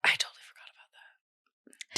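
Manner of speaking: hushed whispered aside. Real, not acted. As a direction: whisper it to yourself.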